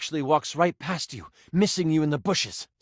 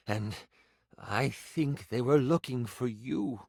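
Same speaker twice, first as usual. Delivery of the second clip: village priest. Acted. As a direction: praise the goddess, then sink into bad news. Voices the priest greeting the returned player.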